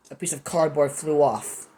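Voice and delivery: ordinary delivery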